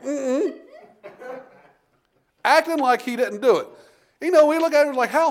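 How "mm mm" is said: high mock-innocent hum with a laugh; guilty denial. Junior denying to mom, mock-innocent hum. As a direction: play the boy denying with his mouth full. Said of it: "And he's like".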